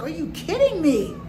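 loud, high disbelief; loud exasperated are you kidding me; incredulous outburst. Retold or imitated. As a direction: blurt it out in shock. Disbelief at airline agent.

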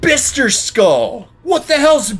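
high, loud, slow mocking yell at the name; mock outrage. Real, not acted. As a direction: scoff at the silly name.

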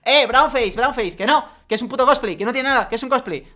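imitando a la gente indignada, más agudo y más fuerte; gritos burlones imitados. Retold or imitated. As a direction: remeda a los ofendidos con un chillido exagerado. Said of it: "y la gente dijo". (burla de la multitud que acusa de brownface, imitando gritos agudos)

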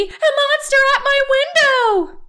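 high, loud panicked cry; frightened shout. A narrator's reading. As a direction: keep screaming about the window monster. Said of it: "shouted Baby".

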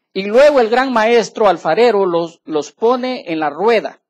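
loud, slow, emphatic sermon delivery; fiery preaching. Acted. Pastor preaching to the congregation, loud and slow. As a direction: preach every word out to the congregation. Reading scripture loudly.

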